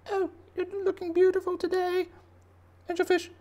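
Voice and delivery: tiny, very high falsetto creature voice; flattering cleaner shrimp. Acted. Cleaner shrimp complimenting angelfish, high flattering squeak.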